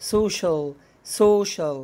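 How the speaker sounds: social said so-shul; teacher demo of social with sh; sh sound in social. Shown as a demonstration. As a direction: social slowly stretched with sh. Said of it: "الكلمة اللي بعد كده".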